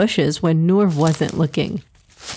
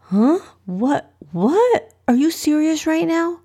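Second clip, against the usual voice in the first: incredulous, rising high-pitched questions; confused; 9 semitones higher than usual. A narrator's reading. Ibrahim to Noor wanting a pet rat, confused disbelief. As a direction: ask in growing disbelief, climbing higher. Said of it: "he asked in confusion".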